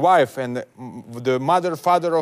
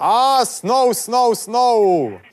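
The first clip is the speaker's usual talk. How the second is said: excited snow; 5 semitones higher, much slower than usual. Acted. Prank caller marvels at snow.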